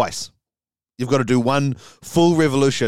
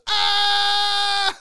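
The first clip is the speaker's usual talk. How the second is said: startled scream. Acted. She screams back in shock.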